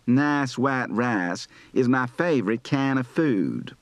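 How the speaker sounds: slow Charlotte North Carolina accent, "rice" as "rahs"; Charlotte Southern accent. Shown as a demonstration. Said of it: "North Carolina, Charlotte".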